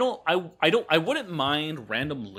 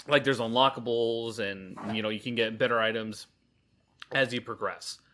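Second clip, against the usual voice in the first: ordinary talk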